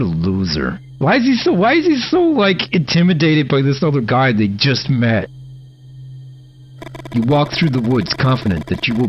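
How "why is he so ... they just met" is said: very high exasperated squeak. Real, not acted. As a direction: squeak it in disbelief. Exasperated at the character.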